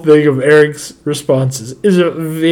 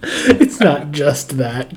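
slow emphatic; much slower than usual. Retold or imitated. Repeating the line with emphasis.

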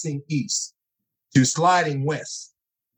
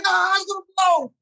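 much higher, frantic alarm; panicked. Retold or imitated. Trapped in the flipped truck, frantic high panic. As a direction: yelp it like the truck's about to explode. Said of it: "So I'm in the car like".

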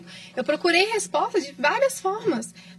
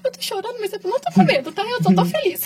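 higher, tearful and happy; tearful joy; 7 semitones higher, 4 dB louder than usual. Retold or imitated. Patient hugging her doctor before surgery, tearful and happy. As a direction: gush through tears that you're happy, not scared. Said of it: "eu abraçava".

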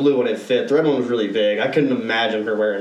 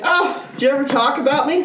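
higher, lightly voiced girl asking; a hint of her coy voice; imitating her question, pitched up; mock girl voice; 12 semitones higher than usual. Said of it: "she's like".

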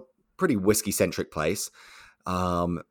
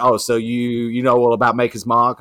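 imitated loud challenge; grilling; 3 semitones higher, 7 dB louder than usual. Rep testing the bar manager on her brand, grilling. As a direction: press the newcomer with a louder, testing question. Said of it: "she's grilling me like this".

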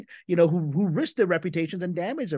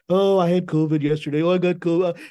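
mocking mantra; 4 semitones higher, 6 dB louder than usual. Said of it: "the standard mantra begins".

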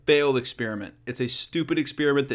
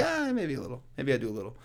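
sheepish aside; 3 semitones lower, 8 dB quieter than usual. Acted. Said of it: "I'm like".

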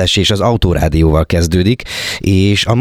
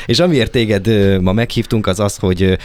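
ordinary delivery

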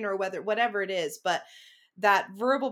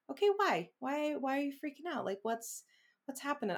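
higher and softer, coaxing yourself; higher gentle coaxing; 7 semitones higher, 5 dB quieter than usual. Retold or imitated. Speaker coaxing her anxious self, higher and softer. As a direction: ask kindly, softening as you go. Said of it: "So I'll be like".

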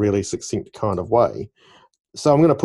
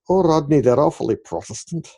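put-on lilting Scottish accent, pitched up a little; 5 semitones higher, 3 dB louder than usual. Girlfriend remarking to Rodney at a garage sale, in a Scottish accent. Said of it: "that was a Scottish accent".

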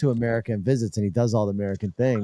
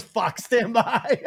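a high, slow, laughing "Fuck, stand by."; mock her, high and stretched out, through a laugh; a high mimicry that dissolves into laughter; 11 semitones higher, much slower than usual. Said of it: "she goes".

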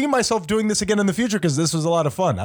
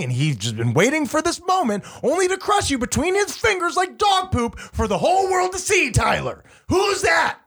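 climbing higher and higher, then shout the question; building quiz shout; 8 semitones higher than usual. Pretend. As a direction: wind up the clue and yell the reveal.

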